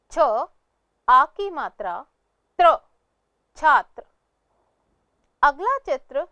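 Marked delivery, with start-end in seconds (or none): 0.1-4.0 "छ, आ की मात्रा, त्र" in separate pieces, then "छात्र"; sounding out. Shown as a demonstration. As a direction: spell "छात्र" aloud piece by piece, then blend it. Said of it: "इसका उच्चारण इस प्रकार है". ("छात्र" का टुकड़ों में, फिर पूरा उच्चारण)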